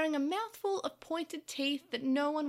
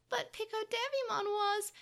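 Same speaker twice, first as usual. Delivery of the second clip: high, slow, pleading objection; protesting; 9 semitones higher, slower than usual. A narrator's reading. Tokomon tries to explain to Takeru, high protest. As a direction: try to get a defence heard.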